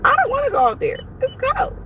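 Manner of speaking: squeaky high, whining about the cold; high whine. Pretend. Complaining about the cold car in a squeaky whine. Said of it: "whining about cold".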